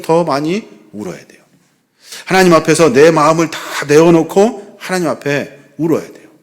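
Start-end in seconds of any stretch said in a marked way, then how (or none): none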